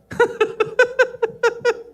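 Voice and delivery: high burst of laughter; hearty laugh. Real, not acted. Pastor laughing about the flip-flop spankings, high and hearty. Laughs after chancletazos joke.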